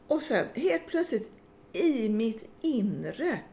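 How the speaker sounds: normal talking voice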